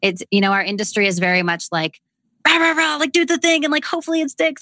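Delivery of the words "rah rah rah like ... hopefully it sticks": high, pumped-up cheerleader voice; lightly mocking the hype. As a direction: mimic a rah-rah motivational coach. Mocking hype industry talk.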